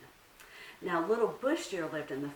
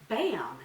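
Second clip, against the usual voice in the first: bam; 4 semitones higher, 7 dB louder than usual. A narrator's reading. Sound effect in story.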